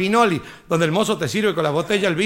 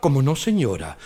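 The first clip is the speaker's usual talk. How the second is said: waiter; 7 semitones lower than usual. Retold or imitated. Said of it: "waiter answers".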